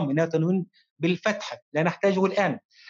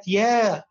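"يا" held long on a raised pitch; stretched long vowel; 4 semitones higher than usual. Shown as a demonstration. Teacher demonstrates madd to pupil, "يا" drawn out high. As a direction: sustain the aa of "يا", higher and slow. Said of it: "به مد بالألف".

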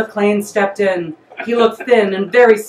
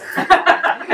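a real burst of breathy laughter; laughing; 9 semitones higher than usual. Real, not acted. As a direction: break into a real laugh at the line. Narrator laughs at own story.

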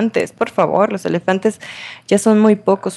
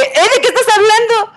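shocked; 3 dB louder than usual. Real, not acted. Shocked reply to accusation.

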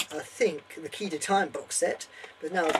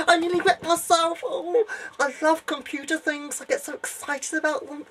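much higher, giddy nerd voice with giggles; excited computer geek; 8 semitones higher, 4 dB louder than usual. Acted. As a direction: gush like a nerd over new Windows.

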